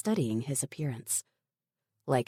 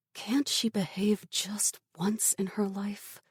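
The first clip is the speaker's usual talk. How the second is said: breathy private whisper of frustration; whispered curse; 7 semitones higher than usual. A narrator's reading. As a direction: mutter it under your breath. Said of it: "secretly cursing Dylan".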